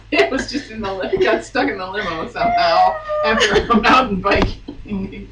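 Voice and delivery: giggling through the words; laughing. Real, not acted. Telling the mock tale to her cohost, laughing hard. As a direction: try to talk while cracking up.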